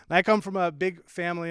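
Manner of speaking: usual speaking voice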